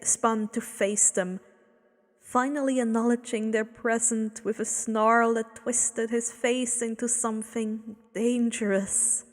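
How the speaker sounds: ordinary delivery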